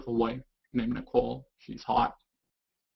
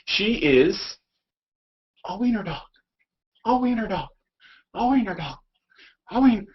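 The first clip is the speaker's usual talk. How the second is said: higher, sing-song talk to the dog; 10 semitones higher than usual. Real, not acted. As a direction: coo it to the little dog. Talking to the dog.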